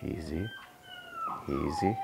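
calm the dog down gently; calm easy. Real, not acted. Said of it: "my energy really impacts the dogs".

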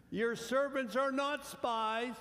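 high, loud, slow insistence; pleading protest. Acted. Brothers plead with Joseph, slow high and loud. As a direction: insist you are no spies.

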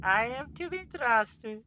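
high, slow mock Mexican accent. Quoting the hot sauce bottle, high and slow in a mock Mexican accent. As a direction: stretch it out in a put-on Mexican accent. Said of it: "in a Mexican accent".

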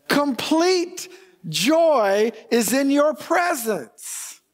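complete sung out high and slow; stress on complete. Shown as a demonstration. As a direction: lean hard on complete, lifting it high. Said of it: "I cannot emphasize that word there enough".

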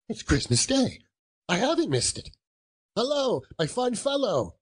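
high-pitched, giddy relief; exult, then call out a hearty greeting; overjoyed. Acted. Scrooge learns he hasn't missed Christmas, overjoyed.

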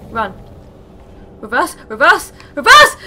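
panicked yelling. Real, not acted. Gamer fleeing a dragon, yelling frantically. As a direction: scream at yourself to back off.

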